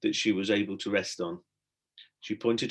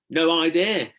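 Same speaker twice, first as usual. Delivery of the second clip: higher, louder shout back; 7 semitones higher, 7 dB louder, slower than usual. A narrator's reading. Stella to Tom on the tree, shouting. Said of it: "shouted Stella".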